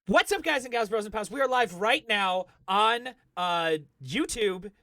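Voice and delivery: loud, high-pitched hype greeting; confident stream greeting. Pretend. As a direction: open big for the Snapchat viewers. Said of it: "You just gotta talk with confidence".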